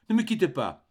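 cling to him with the words; frightened plea. A narrator's reading. Mother begging her son not to leave, frightened.

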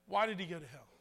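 ordinary talk, nothing marked

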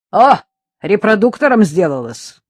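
громко, напористо, на всю квартиру; греми из кухни, чтобы слышали все; гремящий голос. A narrator's reading. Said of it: "Из кухни гремел голос Кондаковой". (громогласная соседка)

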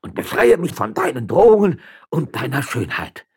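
loud, high-pitched frightened outburst. A narrator's reading. Frightened man fends off a threatening beauty, frightened outburst. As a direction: cry out in fright, voice pushed high. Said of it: "antwortete der Geängstigte".

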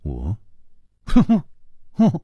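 stammering with a rueful little laugh; wry laugh. A narrator's reading. Hero bewildered by the swordsman's claim, wry laughter. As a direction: laugh it off, caught off guard. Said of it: "苦笑着说".

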